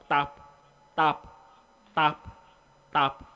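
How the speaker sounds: single clipped beats with even gaps; regular tapping pace. Shown as a demonstration. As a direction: say each "tap" in time with a ticking clock. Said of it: "You are tapping at a regular pace".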